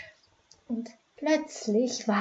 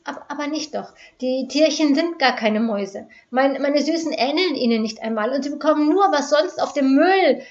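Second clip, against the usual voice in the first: shaky, higher pleading voice; trembling voice; 4 semitones higher, 6 dB louder than usual. Acted. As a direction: plead with a shaking voice. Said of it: "log Ute mit zitternder Stimme".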